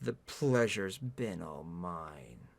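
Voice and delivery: slow, low, soft courtesy; suave, hushed gentleman; polite. A narrator's reading. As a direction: murmur it politely, drawn out and deep. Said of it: "polite as ever".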